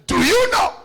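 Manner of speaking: high, loud rhetorical cry; preaching shout. Acted. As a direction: fling the question at the hall. Preacher calls out.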